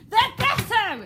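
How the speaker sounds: high loud climax of rage; furious shout. Shown as a demonstration. King ordering the girl gone, furious shout. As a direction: roar it as the fist comes down. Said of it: "almost banging his fist".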